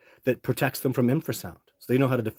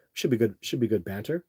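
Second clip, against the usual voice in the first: ordinary talk, nothing marked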